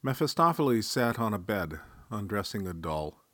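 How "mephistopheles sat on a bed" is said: plain reading voice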